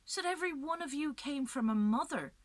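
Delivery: high, loud desperate plea to the crowd; pleading appeal. A narrator's reading. Macha begs the crowd for mercy, loud, high and desperate. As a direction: beg the crowd, voice climbing high. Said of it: "she looks at them and she says".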